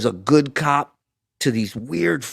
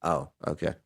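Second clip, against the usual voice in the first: sheepish; 11 dB quieter than usual. Retold or imitated. Sheepish reply, much softer.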